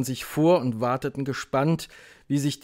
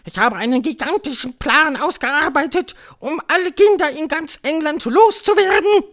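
high, loud announcement; barking Grand High Witch; 9 dB louder than usual. A narrator's reading. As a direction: proclaim your plan in a witch screech. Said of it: "bellte die Hoch". (bellende Hexe, hoch und laut verkündend)

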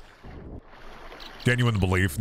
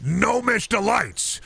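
high, pushy infomercial pitch; hard-sell the gnome pills; supplement pitch; 5 semitones higher than usual. Acted. Host pitching supplements to his listeners, pushy sales voice.

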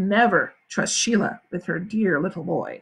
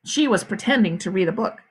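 ordinary delivery, nothing marked

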